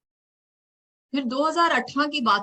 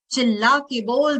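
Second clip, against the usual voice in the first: louder, rising call with बोल stressed; rallying call; 5 semitones higher, 6 dB louder than usual. Acted. Poet urging girls to speak up, louder call. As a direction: urge her to shout it out. Poem urges shouting.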